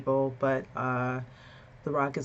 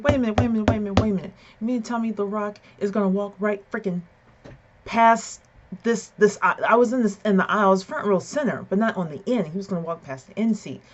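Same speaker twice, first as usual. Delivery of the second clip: louder, higher, rapid-fire incredulity; retold excited disbelief; 5 semitones higher, 6 dB louder, faster than usual. Fan questioning security guard about The Rock, excited disbelief. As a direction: replay it as a stunned, worked-up fan.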